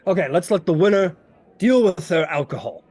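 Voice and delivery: hearty, inquisitive character voice; lightly voiced tavern host. Froome questions the party over drinks, hearty host. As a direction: hold court with the newcomers.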